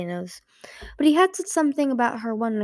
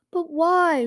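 higher, louder cry of protest; cried out; 9 semitones higher, 7 dB louder than usual. A narrator's reading. Peril protesting to Sunny about rescuing Scarlet, crying out. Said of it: "she cried".

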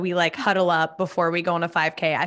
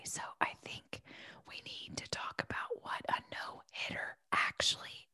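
whispered explanation; 19 dB quieter than usual. Shown as a demonstration. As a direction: whisper so you don't jinx it. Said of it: "I'm gonna whisper".

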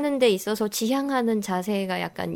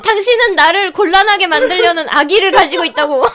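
dramatic accusation; 3 semitones higher, 7 dB louder than usual. Acted.

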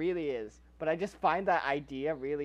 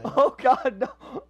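high-pitched dismay through laughter; laughing horror; 6 semitones higher, 7 dB louder, much slower than usual. Real, not acted. Imagining a girl finding the murder tape, laughing horror. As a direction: protest helplessly while laughing.

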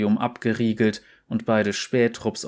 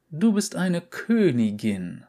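hoch und langsam, feierlich; Tercys Stimme; 7 semitones higher than usual. Acted. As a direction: sprich wie eine strenge alte Dame. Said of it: "hatte Tercy zu ihr gesagt". (leicht gespielte alte Direktorin)

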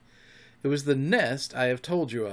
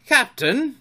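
high, eager hail; raised, keen voice; 12 semitones higher, 7 dB louder than usual. A narrator's reading. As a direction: call up eagerly to the boss. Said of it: "Smee's eager voice".

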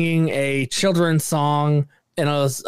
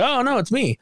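mock startled friend; 11 semitones higher than usual. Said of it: "And they're like".

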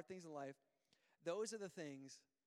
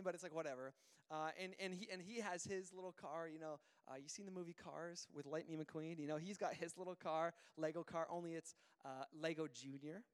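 ordinary delivery

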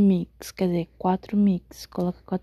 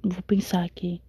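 whispering; 3 semitones lower than usual. Real, not acted. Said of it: "eu tô sussurrando porque eu tô com sono".

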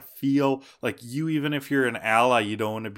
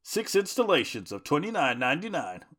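infomercial; 7 semitones higher, 4 dB louder than usual. Acted. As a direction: sell it like a late-night pitchman. Parody ad for Primeape workout tapes.

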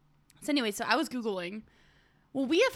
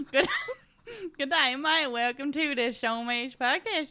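hammed-up, botched Aussie accent; bad Australian accent; 4 dB louder than usual. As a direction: badly fake an Australian podcast host. Attempts the Australian accent just demonstrated.

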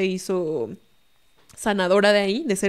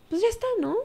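high-pitched, imitated coaxing; coaxing quote; 10 semitones higher than usual. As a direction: retell it as a light, high plea. Retells parents coaxing each other. (imitando un ruego con voz aguda)

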